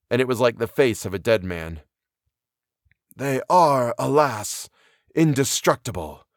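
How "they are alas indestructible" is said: measured, grave pronouncement with pauses; even metallic ring. A narrator's reading. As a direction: state it evenly, like cold metal. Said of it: "continue with its even metallic ring".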